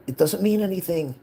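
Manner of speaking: high, loud exasperated snap at mom; arguing with mom about her antiques, loud and high; retold loud retort.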